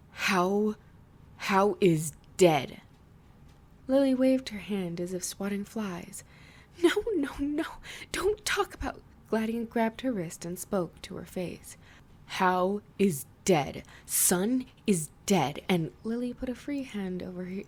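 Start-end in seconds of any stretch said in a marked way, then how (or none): 0.1-2.8 slow, loud demand; stunned angry demand. Acted. Gladian demands answers. 6.8-9.0 high, breathless pleading whisper; panicked whisper. Acted. Lily refuses to hear. 12.2-15.6 slow, loud demand; stunned angry demand. Acted. Gladian confronts Lily face to face.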